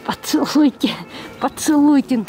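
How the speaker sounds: usual speaking voice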